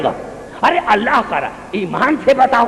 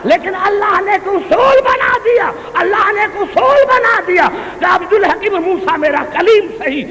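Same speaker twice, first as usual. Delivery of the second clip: higher, shouted sermon climax; preaching at full force; 7 semitones higher than usual. Acted. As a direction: drive the principle home to the crowd. (پورے جوش سے خطابت)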